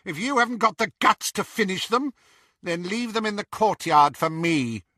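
scornful witch taunt. A narrator's reading. Bellatrix voiced.

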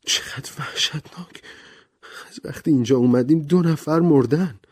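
high, breathless horror fading in; a gasped whisper of horror, then aloud; horrified gasp. Acted. Vera reacts to second death.

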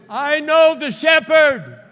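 very high, slow, booming shout; full-force preacher's shout. Real, not acted. Preacher repeating the declaration to the church, shouting full force. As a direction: shout it out at the top of your voice, drawn out.

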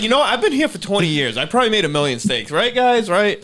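cheerful cook. Retold or imitated. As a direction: rattle it off proudly to coworkers. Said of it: "she's like".

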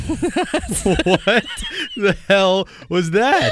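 high, loud words through laughter; laughing. Real, not acted. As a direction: crack up mid-sentence. Laughing at the silly yell.

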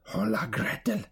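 high-pitched witch's call; crying witch. A narrator's reading. Impatient witch summoning Gretel, calling out high. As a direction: call the girl sharply in a witch voice. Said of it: "she cried to the girl".

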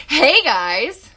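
loud, high, bright "Hey, guys!"; energetic intro greeting. Shown as a demonstration. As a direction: show how a real intro sounds. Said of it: "I got this".

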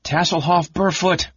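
high-pitched calling out; mild acted yell for someone missing. A narrator's reading. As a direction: call the name out high, without full volume.

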